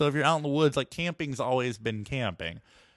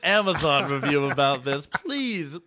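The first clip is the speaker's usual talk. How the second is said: louder, lightly acted insistent author; mock self-promoting author; 3 semitones higher, 5 dB louder than usual. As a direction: press emphatically for credit, hammed-up. Acting Anne Rice demanding credit.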